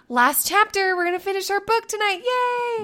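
high, sing-out cheer; cheery note. Retold or imitated. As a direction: read it out with building glee, rising into a drawn yay. Reads out own cheery note.

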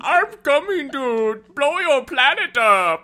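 high, loud, slow alien voice; bad cartoon Martian; Marvin the Martian. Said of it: "that was a horrible Marvin".

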